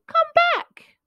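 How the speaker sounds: high, loud calling. Acted. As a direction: call after him. Calls friend flying away.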